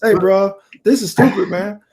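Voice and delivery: high-pitched, worked-up protest; agitated complaint. Retold or imitated. Benched player calling his agent, agitated complaint. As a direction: vent the frustration, imitating an angry phone call.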